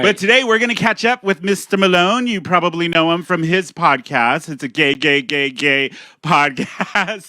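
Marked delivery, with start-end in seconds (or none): 4.5-7.3 drawn-out title. Shown as a demonstration. Host announcing the guest's podcast, drawn out slowly. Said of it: "host names the podcast title".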